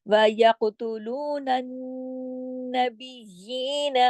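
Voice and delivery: "यक़्तुलूनन" with heavy echoing q, slow; recitation demo. Shown as a demonstration. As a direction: recite "यक़्तुलूनन" slowly, q bounced. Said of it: "काफ मोटा भी, काफ पे कलकला भी".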